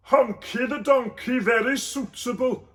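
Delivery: dry comment through the stench; gagging Joseph. Acted. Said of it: "said Joseph".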